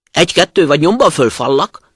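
loud wolf's threat; growled threat. A narrator's reading. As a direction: threaten the miller, loud and forceful. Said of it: "de a farkas rámordult". (hangosan rámordulva, fenyegetően)

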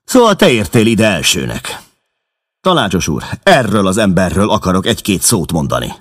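furious rival confronting the newcomer; angry challenge. Acted. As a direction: glare and spit it out. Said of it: "dühös pillantást vetve McMurdo-ra".

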